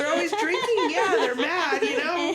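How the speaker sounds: put-on high laughing Irish accent; stage-Irish voice, laughing through it. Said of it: "I could have my Irish accent".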